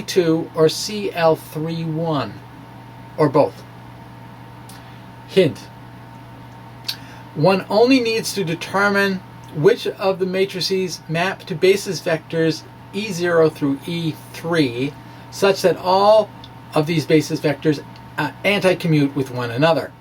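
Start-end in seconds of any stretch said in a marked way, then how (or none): none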